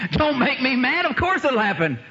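high, insistent comeback; acted indignant confidence. Repeats husband's reply.

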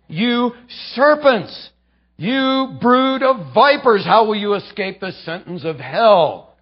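preacher voicing Jesus's condemnation; thundering rebuke. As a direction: denounce them with fury. Jesus denouncing Pharisees.

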